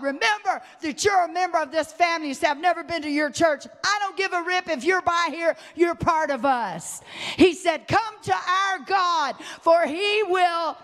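high, forceful revival-style exhortation; full-force preaching. Real, not acted. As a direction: proclaim it loud and high, stretching key words.

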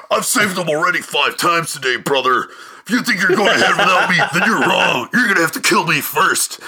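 put-on over-the-top American accent, pumped and laughing; ridiculous American accent. Butler boasting to the SAS soldiers, in a ridiculous American accent. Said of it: "such a pronounced, ridiculous American accent".

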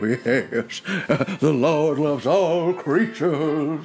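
a hint of a slow pious husband; lightly voiced old churchgoer, slow. Husband answers in role.

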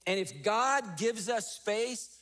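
ordinary talk, nothing marked

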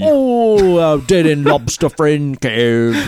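slow put-on Cockney accent. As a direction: stretch it out in Cockney. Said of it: "in your Cockney accent".

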